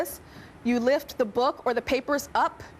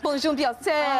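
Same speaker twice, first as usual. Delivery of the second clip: put-on French accent; 2 semitones higher, slower than usual. Greeting in French.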